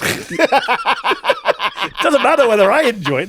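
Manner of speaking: slow, laughing through the words; a man laughing at his own stubbornness. Real, not acted. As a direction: laugh as you admit it. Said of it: "laughing at himself".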